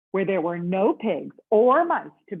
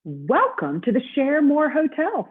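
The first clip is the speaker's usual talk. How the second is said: bright, slow, higher hotel bellhop greeting; 8 semitones higher, 5 dB louder, slower than usual. Acted. Bellhop welcoming Mister Snore, high and cheery.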